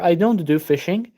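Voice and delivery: usual speaking voice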